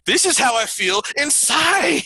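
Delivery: high, slow parody of a Chappelle show line; Chappelle sketch quote. Retold or imitated. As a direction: stretch it out high and mock-sincere. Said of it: "back to that Chappelle show".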